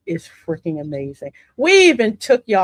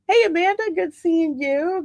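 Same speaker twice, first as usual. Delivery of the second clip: cheery greeting; 8 semitones higher than usual. Real, not acted. Greeting friend on camera.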